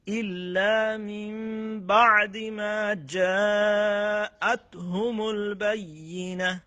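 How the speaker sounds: Quran recitation. Shown as a demonstration. Teacher reciting the verse for students, slow melodic chant. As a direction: chant it with long held vowels. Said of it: "এভাবে পড়বেন".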